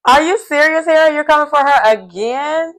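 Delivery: high-pitched, disbelieving question; incredulous. Retold or imitated. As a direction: ask it high, in open disbelief. Voices disbelief at Harry's accusation.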